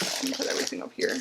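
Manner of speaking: fast, breathy whisper; hurried whispered aside. Real, not acted. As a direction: mutter it quickly to yourself. Quick aside while tidying.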